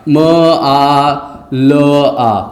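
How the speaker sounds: low, separate म, आ, ल, आ with gaps; sounded out letter by letter. Shown as a demonstration. Teacher showing the class a wrong reading, म आ ल आ pulled apart. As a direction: pause after each sound, म / आ / ल / आ. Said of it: "मुआला हो जाएगा".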